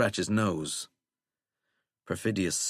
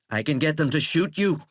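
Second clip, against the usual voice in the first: raised, tense threat; angry threat; 7 semitones higher, 4 dB louder than usual. A narrator's reading. President threatening Withering with agents, angry. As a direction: threaten him, pitch up.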